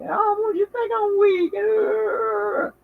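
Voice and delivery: high put-on voice of an offended child; imitating her child. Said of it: "she be like".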